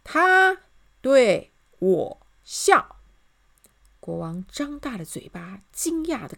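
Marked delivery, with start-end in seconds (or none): 0.1-3.0 very slow, loud, each word apart. A narrator's reading. Soldier repeats the crime to the king, word by word. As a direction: punch out every word separately. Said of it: "一个字一个字慢慢地说".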